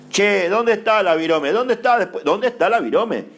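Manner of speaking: high-pitched, agitated questioning; mock frantic searching. Preacher acting someone who lost the entrusted pen, mock panic.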